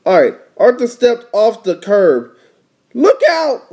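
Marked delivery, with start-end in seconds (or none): 3.0-3.7 higher-pitched warning call. Acted. Little sister warning her brother at the curb, higher voice. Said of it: "said D W".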